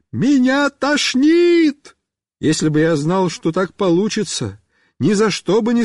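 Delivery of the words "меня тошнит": slow, high wailing shout; long high yelled complaint; calling back. A narrator's reading. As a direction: holler it in distress, stretched out. Rider shouts back, feeling sick.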